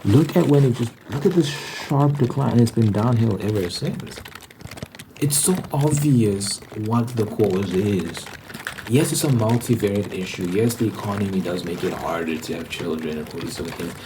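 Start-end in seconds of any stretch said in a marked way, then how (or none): none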